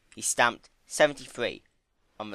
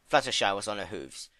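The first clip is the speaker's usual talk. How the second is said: ordinary delivery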